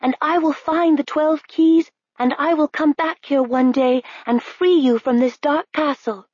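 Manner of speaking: put-on high, resolute girl's voice; high determined princess. A narrator's reading. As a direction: vow it bravely. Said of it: "said Princess Nell".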